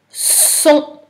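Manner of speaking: Son high, with a long initial s; dictated syllable. Shown as a demonstration. Teacher dictating a syllable to pupil, high, drawn-out Son. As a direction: hold the s, then lift Son high.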